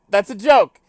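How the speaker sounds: usual speaking voice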